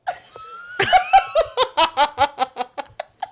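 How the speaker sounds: long, high, loud laugh; real laughter. Real, not acted. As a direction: burst out in a high laughing fit. Laughing after demonstrating the stare.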